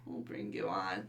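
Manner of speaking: whispered echo. Real, not acted. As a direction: echo it under the breath. Echoes co-host.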